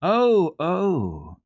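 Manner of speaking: put-on high, bright child's cry of delight. A narrator's reading. Heidi gaily protests to the doctor, high and bright. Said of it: "exclaimed Heidi quite gaily".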